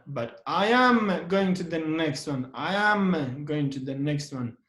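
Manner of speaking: am pushed out and held; stress on am. Shown as a demonstration. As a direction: defend yourself by leaning on am. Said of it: "We put a stress on the am".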